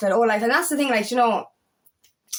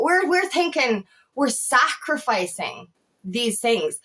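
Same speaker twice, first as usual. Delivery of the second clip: ordinary talk, nothing marked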